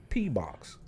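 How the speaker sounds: whispered. Real, not acted. Whispered crude word.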